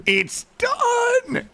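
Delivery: high elated stretched cry; overjoyed it's finished; ecstatic squeal. Real, not acted.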